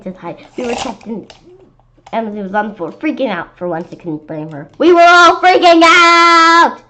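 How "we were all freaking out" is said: slow, much louder, higher panic; panicked. A narrator's reading. Kid telling the scary moment, loud slow panic. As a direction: wail it out.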